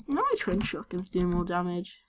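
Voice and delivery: usual speaking voice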